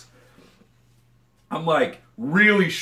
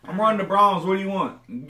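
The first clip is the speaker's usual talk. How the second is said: higher mock Okie voice; Oklahoma twang; 5 semitones higher, 4 dB louder than usual. Imitating an Oklahoma friend's errand call, mock twang. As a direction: ham up a local guy. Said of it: "he'd be like".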